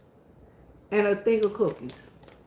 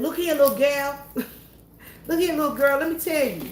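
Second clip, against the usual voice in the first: uncle scolding; 5 semitones higher than usual. Retold or imitated. Voicing her uncle scolding his niece, high mock warning. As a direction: put on the uncle's voice, wagging a finger.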